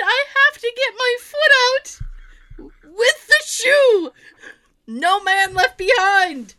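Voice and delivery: high, loud, heroic plea through laughter; mock-heroic vow, cracking up; laughing dramatic plea. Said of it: "And I said".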